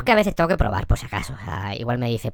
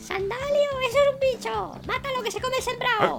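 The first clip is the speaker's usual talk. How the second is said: much higher, panicked village woman; shrill high woman's shriek; 6 dB quieter than usual. Acted. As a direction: shriek in alarm at a creature. Shepherd's wife shrieks.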